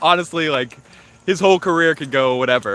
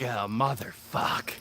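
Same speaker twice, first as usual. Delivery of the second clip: whispered curse; 4 semitones lower, 12 dB quieter, slower than usual. Retold or imitated. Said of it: "right now I'm like".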